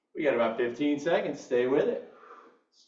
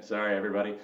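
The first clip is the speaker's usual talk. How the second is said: ordinary talk, nothing marked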